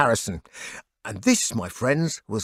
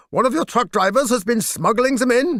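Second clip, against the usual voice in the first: lightly voiced polite German officer, higher; a hint of a courteous cop explaining; policeman; 9 semitones higher than usual. Officer explaining the drug bust to the singer, lightly voiced.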